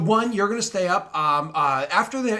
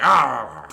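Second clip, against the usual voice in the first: exasperated groan; 10 dB louder than usual. Retold or imitated. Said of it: "he eventually".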